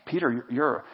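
low voice, sinking very soft; tender affirmation. Acted. Jesus looking Peter right in the eye, low and tender. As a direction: speak it quietly with long, weighty pauses.

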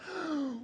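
big theatrical intake of air; exaggerated deep breath. Child summoned by parents, hammed-up deep breath before answering. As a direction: suck in a huge mock-weary breath. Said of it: "you must take a deep breath".

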